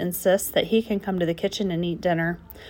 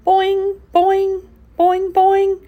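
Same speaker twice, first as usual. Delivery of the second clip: rising, louder boing calls, pitched up high; twangy frog calls from the swamp, higher and louder each time; 12 semitones higher, 8 dB louder, much slower than usual. A narrator's reading. Frog friends calling from the swamp in high loud boings.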